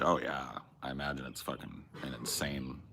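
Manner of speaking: ordinary talk, nothing marked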